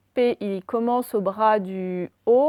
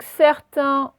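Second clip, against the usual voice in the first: dictée lente; 4 semitones higher, 5 dB louder than usual. Shown as a demonstration. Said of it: "dictée lente".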